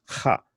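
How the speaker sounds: ha alone, rasped at the back of the throat; guttural kh. Shown as a demonstration. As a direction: make ha a throaty kha. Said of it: "the guttural sounds".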